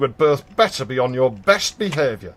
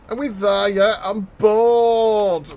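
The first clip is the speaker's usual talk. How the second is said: bored whining; 6 semitones higher, 5 dB louder, slower than usual. Acted. Bored child in back seat.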